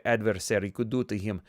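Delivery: ordinary delivery, nothing marked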